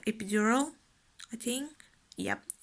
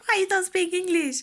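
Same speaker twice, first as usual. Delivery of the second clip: loud, raised-pitch mock demand; imitated husband; 10 semitones higher, 7 dB louder, faster than usual. Husband asking his labouring wife, loud mock exasperation. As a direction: play up an exasperated husband's question. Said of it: "my husband was like".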